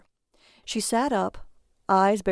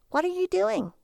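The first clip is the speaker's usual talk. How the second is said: annoyed; 11 semitones higher than usual. A narrator's reading. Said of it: "annoyed by the small amount".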